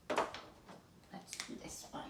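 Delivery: slow whispered aside. Real, not acted. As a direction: whisper it to yourself.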